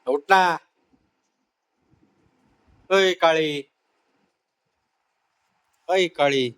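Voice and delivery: loud scolding. Acted.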